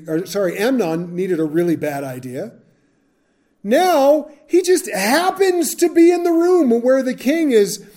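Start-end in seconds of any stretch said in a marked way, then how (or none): 3.6-7.8 higher, disbelieving emphasis; mock disbelief at how conveniently he shows up; incredulous. Real, not acted. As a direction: sound amazed at the coincidence. Mocking Jonadab's convenient timing.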